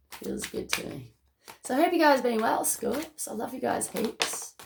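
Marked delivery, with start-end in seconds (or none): 0.1-1.1 the words breathed out in a soft whisper. Real, not acted. Vlogger sensing the message, whispering it to herself. 3.3-4.5 whispered. Real, not acted. Affectionate sign-off to Scorpios.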